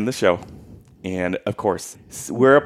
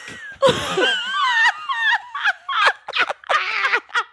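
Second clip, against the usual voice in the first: high shrieking laugh. Real, not acted. Sister cracking up at the performer's story, shrieking laugh.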